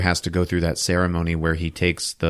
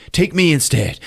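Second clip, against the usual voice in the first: raised-pitch mock plea; acted plea; 7 semitones higher than usual. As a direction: plead to be taken in his place. Acted plea, first take.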